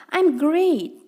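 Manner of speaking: high upbeat I'm great; cheerful English demo. Shown as a demonstration. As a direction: say it like you feel great.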